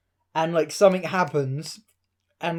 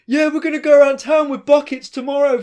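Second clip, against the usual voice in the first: higher, louder rowdy mock call; drunk mate shouting; 7 semitones higher, 7 dB louder than usual. Drunk friend jeers from the background, higher and louder mock shout. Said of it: "I can hear him in the background".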